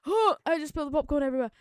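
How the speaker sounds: sudden alarmed gasp; startled. Real, not acted. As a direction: caught off guard, blurting it out. Spilled popcorn mid-stream.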